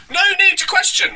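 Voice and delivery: very high-pitched full-voiced proclamation; mock preacher. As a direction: declare it as a squealing caricature preacher. Mocking quote of preacher's comment.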